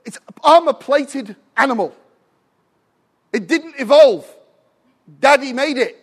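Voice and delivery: loud, high revival preaching; preaching at full force. Real, not acted. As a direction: proclaim it to the congregation.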